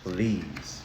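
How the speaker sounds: "please." said low and very soft, drawn out. Shown as a demonstration. Teacher showing kids the soft word "please.". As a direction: breathe "please." low and gently. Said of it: "you still have to use the word".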